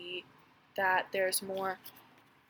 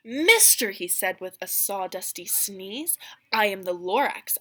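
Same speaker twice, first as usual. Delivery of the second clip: put-on lively read-aloud narration for children; storybook reading; 7 dB louder than usual. A narrator's reading. Teacher reading The Lorax to her class, lively storybook voice. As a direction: read it like a picture book to a class. Reads Lorax meeting scene.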